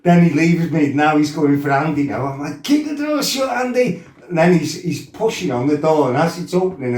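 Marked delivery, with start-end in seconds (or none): 2.6-4.0 panicked shout. Retold or imitated. Telling his mate to barricade the bathroom, high panicked shout. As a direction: bark it while holding the door.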